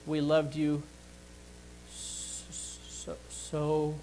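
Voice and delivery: halting "s-, s-, so", fading to breath; mother at her child's funeral, voice breaking on "so". Retold or imitated. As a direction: retell a grieving mother's choked, quivering words. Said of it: "her voice would quiver and, and fade out".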